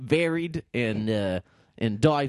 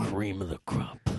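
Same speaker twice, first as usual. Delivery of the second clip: slow wrestler catchphrase; 4 semitones lower, 5 dB quieter than usual. Retold or imitated. Macho Man catchphrase impression.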